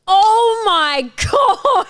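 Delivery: slow, loud, high laughing cry; laughing disbelief. Real, not acted. Friend cracking up at the bed story, loud and drawn out. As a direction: stretch it out while cracking up.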